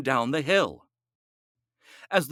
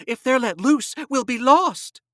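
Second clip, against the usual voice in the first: high, louder frightened woman; fearful woman; 10 semitones higher, 4 dB louder than usual. A narrator's reading. Woman fearing the demons, high frightened plea. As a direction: plead in terror.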